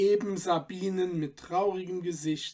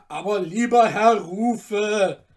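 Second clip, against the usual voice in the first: louder begging voice; 3 semitones higher, 9 dB louder than usual. Acted. Cutler begging the merchant, imploring. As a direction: beg him once more. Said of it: "bat der Messerschmied wieder".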